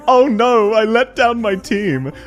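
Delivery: high-pitched hammed-up fretting; mock worrying. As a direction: wail it in exaggerated worry, mocking her. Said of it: "she's constantly worrying".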